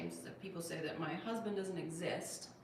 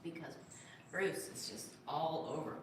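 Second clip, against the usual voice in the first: usual speaking voice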